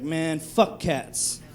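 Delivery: slow, low-energy mock dismissal; reluctant grumble. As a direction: drag it out softly, acting unwilling. Retells his reluctant reply to girlfriend.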